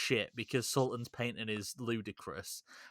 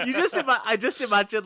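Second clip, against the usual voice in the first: laughing; 12 semitones higher, 9 dB louder than usual. Real, not acted. Laughing at the joke.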